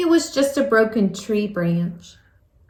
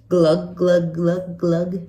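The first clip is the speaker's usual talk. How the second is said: fish glugging; 5 semitones lower, slower than usual. Acted. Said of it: "he mouths".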